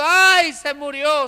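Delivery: loud mock wail on the first cry, then easing; imitated crying. Preacher imitates everyone weeping over the dead girl, mock wail. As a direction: throw out a brief put-on lament. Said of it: "Todos llorando y todos diciendo".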